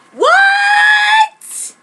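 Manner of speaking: very high, loud shocked shout. A narrator's reading. Girl hearing Aoyama likes her, shocked shout. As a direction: shriek it in disbelief.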